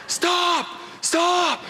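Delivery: high yelled stop, re-enacted; screaming stop. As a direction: shout after the car to make it stop. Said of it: "screaming at the top of my lungs".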